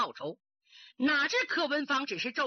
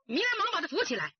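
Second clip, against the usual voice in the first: ordinary delivery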